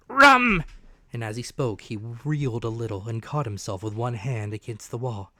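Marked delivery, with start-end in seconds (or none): 0.1-0.6 loud high bark for rum; demanding rum. A narrator's reading. Shaken captain shouts at Jim for rum. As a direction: yell it at the boy.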